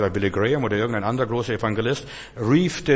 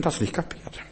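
muttered aside; 5 semitones higher, 5 dB quieter than usual. Real, not acted.

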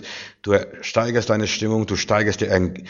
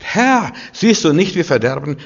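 high, loud cry of despair to the Lord; desperate cry; 7 semitones higher, 5 dB louder than usual. Acted. Preacher acting the disciples crying out in the storm, desperate shout. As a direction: cry out like men about to drown. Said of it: "zum Herrn geschrien? So Luft gemacht".

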